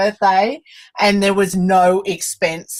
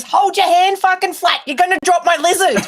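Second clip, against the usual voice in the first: mock grumpy order, pitched higher; grumpy Scott; 9 semitones higher than usual. Handler scolding a guest who won't hold her hand flat, mock grumpy. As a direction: play a cranky handler telling someone off. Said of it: "Grumpy as fuck".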